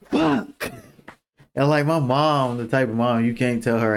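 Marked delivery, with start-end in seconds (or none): 0.1-0.7 1 high mock dismay. Reacting to friend joining.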